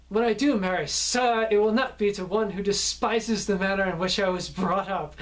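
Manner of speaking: very loud voice. Retold or imitated. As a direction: declaim the line for everyone to overhear. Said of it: "started in a very loud voice".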